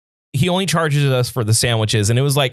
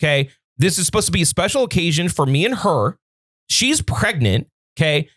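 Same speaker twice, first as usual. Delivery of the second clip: higher, heated retelling of going off; retold rant; 6 semitones higher than usual. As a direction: replay the angry rant at the manager. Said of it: "I went fucking crazy".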